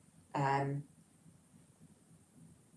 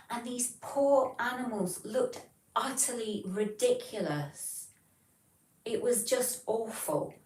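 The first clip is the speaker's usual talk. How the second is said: slow, raised pitch, appalled at it; dismayed disapproval; 6 semitones higher than usual. Real, not acted. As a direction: let the pity and disgust slow you down. Disapproving of dyed show dogs.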